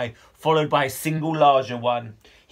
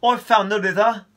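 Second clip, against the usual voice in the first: excited; 6 semitones higher, 4 dB louder than usual. Acted. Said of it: "called Con".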